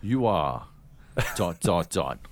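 slow, dropping suspense with long pauses; dramatic pause. Retold or imitated. Pitch dramatic pause reveal.

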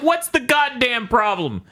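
high, slow exasperated cry; mock exasperated squeal. The podcaster yells at Apple, high slow mock exasperated cry. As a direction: stretch it high in mock despair. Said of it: "And I'm like".

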